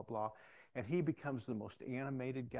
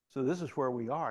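ordinary talk

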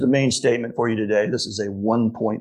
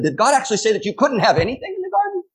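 much higher, fast sneering devil voice; devil's mocking question; 11 semitones higher, faster than usual. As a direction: rattle it off like a sly tempter. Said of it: "the way the devil said it".

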